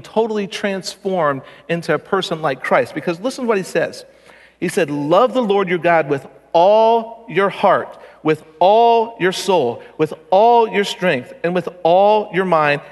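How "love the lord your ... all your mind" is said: "all" drawn out slow and punched each time; stress on ALL. Acted. Preacher showing the demand is impossible, stressing "all". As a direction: lean long and hard on every "all".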